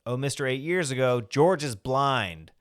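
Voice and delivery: loud, high mock yell, drawing out the end; retold yell. Squeaky yells at Cliff making his way through the house. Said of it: "she yells out".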